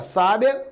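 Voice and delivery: sábia, stressed on the opening SÁ; stress on first syllable. Shown as a demonstration. Teacher showing students how accent changes a word, stress on first syllable. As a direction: hit SÁ in sábia, let the rest fall away. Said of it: "só vai saber se eu pronunciar".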